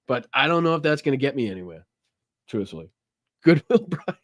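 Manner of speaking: normal talking voice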